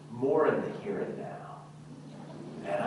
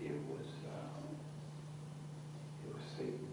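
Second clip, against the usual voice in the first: tiny, hesitant confession; barely audible mumble; 18 dB quieter, faster than usual. Acted. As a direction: let the excuse almost vanish. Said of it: "Speak up, I can't hear you".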